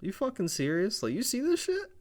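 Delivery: voicing the wrestlers in disbelief, pitch climbing high but kept soft; a hushed, rising "are you serious" at the boss; incredulous quote. Wrestlers glancing back at Nick Aldis, softly incredulous. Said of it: "look back at Nick Aldis like".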